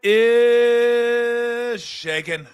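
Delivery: loud, high, hugely drawn-out greeting; big sustained opener; show-opening call. Real, not acted. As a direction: stretch it out like an announcer opening the show. Host's show-opening shout of greeting.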